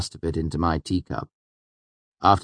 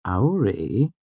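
name drawn out, pitch swooping; tentative, lingering call; slow question; 2 semitones higher than usual. A narrator's reading. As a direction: ask it slowly, stretching the word. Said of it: "I asked slowly".

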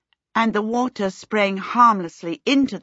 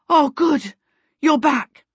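relieved greeting; 8 semitones higher, 5 dB louder, slower than usual. Acted. Peter relieved at her return.

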